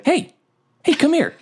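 high-pitched, coaxing little call; beckoning call. Acted. Player puppeting a severed hand at the door, high beckoning call. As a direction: lure someone over in a high voice.

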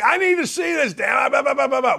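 retold heated complaint, pitched high; mad rant. Host retelling his gripe at the crew, mad rant. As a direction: imitate your own fussing, running into blah-blah babble. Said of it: "So I'm mad at Corey".

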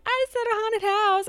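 high-pitched boast to mom; retold squeal of pride; proud announcement. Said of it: "I was so proud of myself".

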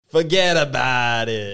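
slow, loud New York "Forget about it"; New York accent. Pretend. Doing the outro in a hammed-up New York accent. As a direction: stretch it out like a tough New Yorker. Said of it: "give us the outro".

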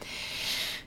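a long breathy sigh; heavy sigh. Real, not acted. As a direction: let out a tired sigh. Sighing during podcast.

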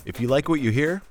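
ordinary delivery

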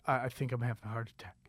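a small frightened murmur to the crowd; scared near-whisper. Retold or imitated. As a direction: barely get the words out, frightened. Panicking quietly on stage.

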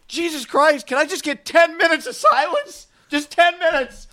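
very high, strained imitated yelling; angry outburst. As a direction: plead furiously for silence at the top of your range. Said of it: "the guy who was angry just goes".

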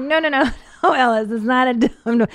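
put-on head the child off quickly; hurried correction. Mom corrects son calling a walker dum-dum, half-laughing protest.

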